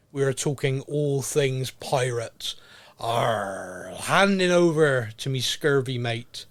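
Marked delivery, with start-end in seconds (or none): 3.0-6.5 mock pirate growl; pirate arr. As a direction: play the pirate for a beat. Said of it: "talk like a pirate".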